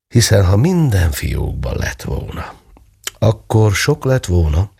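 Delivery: usual speaking voice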